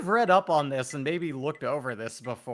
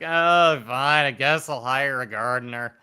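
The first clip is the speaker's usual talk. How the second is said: reluctant rich homeowner; 11 dB louder than usual. Retold or imitated. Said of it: "And it's like".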